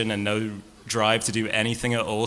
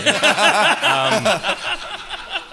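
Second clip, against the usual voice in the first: loud high laugh; hearty high laugh; burst of laughter; 6 dB louder than usual. Real, not acted. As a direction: laugh out hard at the joke. Laughing at own joke.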